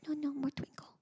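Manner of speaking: put-on high, soft little-girl protest; toddler voice. Mom imitating daughter refusing bedtime. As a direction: plead softly like a toddler. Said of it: "She goes".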